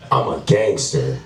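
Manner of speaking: low-pitched "gangster" with the final R sounded; hard R. Retold or imitated. Guy insisting on his credentials, "gangster" with a hard R. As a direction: imitate him, landing on the R ending "gangster". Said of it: "You said gangster with a hard R".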